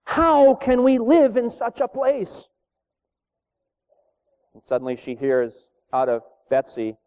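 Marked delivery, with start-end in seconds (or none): 0.0-2.3 high, slow, pleading mock wail; retold wail. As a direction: lament it like a despairing sister, pitched up. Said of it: "I wailed".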